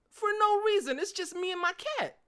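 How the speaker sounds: high, loud hyped-up outburst; pumped up rant, pitched high and loud; getting hype. Real, not acted. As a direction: burst out excited, way up high and loud. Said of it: "I'm getting a little bit hype".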